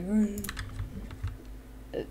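small, soft scared sound; barely voiced frightened whimper; scared guy. Showing the scared guy in the diner, faint frightened noise. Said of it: "we have this scared guy".

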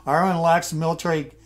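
formal event read. Acted. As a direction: read it like a wartime government briefing. Reads in-game diplomatic event text.

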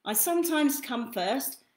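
gentle lively storybook read; reading to children. Acted. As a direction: read it like bedtime for a toddler. Reads second book aloud.

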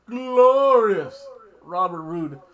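slow, loud drawn-out ring announcer call; announcer hype. Pretend. Hyping up the wrestler with a loud announcer call. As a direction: stretch it like introducing a champion.

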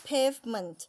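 "pavement" drawn out slowly, every sound clear; slow model word. Shown as a demonstration. Teacher modeling pavement for students to repeat, slowly. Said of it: "พูดตามเลยนะคะ".

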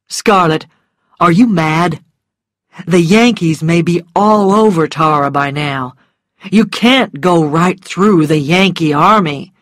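loud, forceful male warning; emphatic, exasperated gentleman at his natural pitch; incredulous man. Acted. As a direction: tell her off in disbelief. Voices Rhett incredulous, warning.

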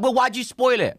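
high, loud imitation of indignant followers; mock outraged complaint. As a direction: complain loudly in a high mocking voice. Repeats followers' complaint about spoilers.

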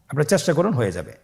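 normal talking voice

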